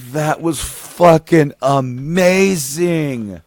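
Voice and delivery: loud, hugely drawn-out stoned amazement; slow-motion popping. Retold or imitated. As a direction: stretch every word out in blown-away wonder. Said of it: "the most slowest of manner".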